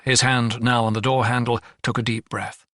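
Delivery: usual speaking voice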